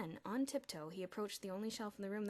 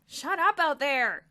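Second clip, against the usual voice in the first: slow, loud yell; 7 semitones higher, 17 dB louder, slower than usual. A narrator's reading. Boy woken at six thirty, yelling. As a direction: bellow it through the door. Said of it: "yelled a voice".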